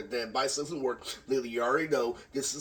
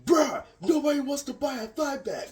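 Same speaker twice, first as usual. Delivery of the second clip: high, loud, slow mocking voice; dismissive friend, pitched way up; mocking Robert; 10 semitones higher, 4 dB louder than usual. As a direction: drag it out high like you're taunting. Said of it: "And so Robert goes".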